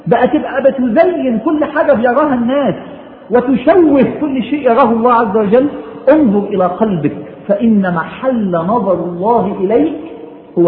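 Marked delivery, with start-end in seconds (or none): none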